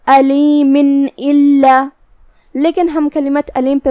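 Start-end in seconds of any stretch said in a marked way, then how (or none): 0.1-1.9 slow recited demo, noon sakin clear without nasal hum; izhar without ghunna. Shown as a demonstration. Teacher reciting a tajweed example slowly. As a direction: recite علیم الا as alimin illa with a plain noon. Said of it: "بغیر غنہ سے حکم کو ادا کریں گے".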